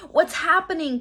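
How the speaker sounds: alarmed high cry; cried out. A narrator's reading. Boy crying out to his sister in alarm. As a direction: cry out as the treehouse spins. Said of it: "cried Jack".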